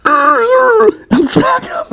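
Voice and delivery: words swallowed in a high slow laugh; laughing squeal. Real, not acted. Retelling wrecking the Humvee, laughing through the words. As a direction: crack up mid-line, stretching it out. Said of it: "laughing at own wreck".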